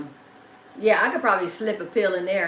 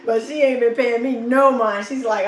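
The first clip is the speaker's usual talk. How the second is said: usual speaking voice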